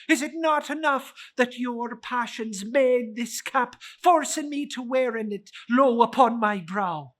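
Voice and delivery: high, loud indignant spirit; the spirit rebukes Scrooge; offended ghost. Acted.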